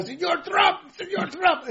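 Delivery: high, hammed-up Chinese-Panamanian accent; mock Chinese-Panamanian accent. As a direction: plead in a comic put-on Chinese-Panamanian accent. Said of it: "This is a China from Panama".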